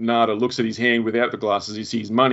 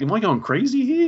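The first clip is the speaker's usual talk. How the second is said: much higher, doubting his own sanity; bewildered panic; 11 semitones higher than usual. Retold or imitated. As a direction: play him wondering if he's lost it. Voicing the stunned hero.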